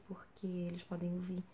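breathy hushed whisper. Real, not acted. Explaining to viewers in a whisper so the hosts upstairs won't hear. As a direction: drop to a whisper mid-sentence. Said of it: "por isso que eu não gosto de gravar vídeo aqui".

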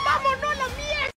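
high, loud outburst; fed-up yell. Acted. Diner fleeing the flying plates, fed-up yelling. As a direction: yell it to get everyone out.

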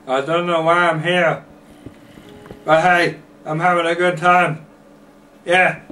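high, loud squeaky monster voice; cartoon creeper chirping along. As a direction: pitch it way up like a silly little creeper. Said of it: "That's how creepers talk".

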